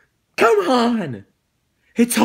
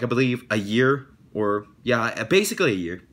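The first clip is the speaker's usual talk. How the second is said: ordinary talk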